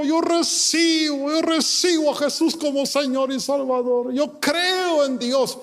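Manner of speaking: imitating a weeping convert, high and drawn-out; retold tearful confession. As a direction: cry out your faith in a high, stretched voice. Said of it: "llorando, diciendo".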